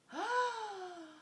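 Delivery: high shocked gasp; a sharp in-breath of shock. A narrator's reading. Narrator reacts to sneaky fox.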